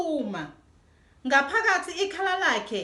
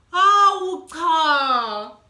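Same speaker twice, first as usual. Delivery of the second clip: very slow, much louder drawn-out protest; scolding protest; 3 semitones higher, 9 dB louder, much slower than usual. Acted. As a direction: wail it out like a cross child. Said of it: "kuthetha uDudu".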